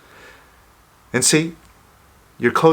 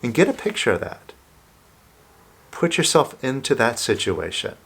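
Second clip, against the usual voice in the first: guided visualization; 2 semitones lower than usual. Acted. Leads a visualization exercise.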